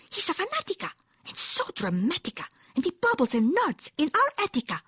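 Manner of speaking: high little dog voice; tiny chihuahua complaining. A narrator's reading. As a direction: rhyme dramatically as a tiny chihuahua.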